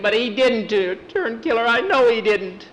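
put-on high, loud boyish denial; boy's desperate protest. Boy contradicting the man about his dog, high desperate protest.

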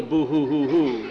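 exaggerated boo-hoo wail; put-on blubbering with a rise in it; mock crying. Child mimics crying back at mother.

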